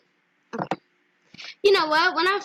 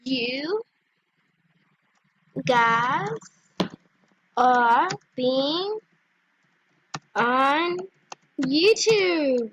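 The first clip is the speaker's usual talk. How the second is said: slow, separated words in a lowered voice; typing aloud; 4 semitones lower, much slower than usual. Real, not acted. Telling players she is a YouTuber, typing aloud. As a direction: dictate it to yourself word by word. Spelling out her chat message.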